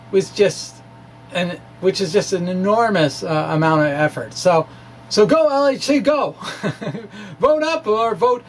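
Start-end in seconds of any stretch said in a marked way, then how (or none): 5.1-8.4 cheering the collider on, half laughing; a light rallying cry breaking into a laugh; playful cheer. Real, not acted. Cheering on the LHC.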